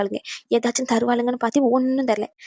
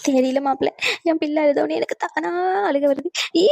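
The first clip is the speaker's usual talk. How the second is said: higher-pitched, hammed-up sobbing; mock crying; 5 semitones higher than usual. Mother-in-law cries because her daughter cries, exaggerated fake sobbing. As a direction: blubber in a silly fake wail. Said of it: "இது என்ன புது அழுகையா இருக்கு".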